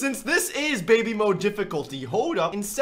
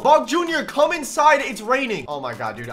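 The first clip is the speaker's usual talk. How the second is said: ordinary talk, nothing marked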